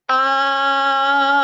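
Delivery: held open ah pushed out with intent, not sung; sustained projected ah. Shown as a demonstration. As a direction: blast the ah through the wall ahead. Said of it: "So here I go".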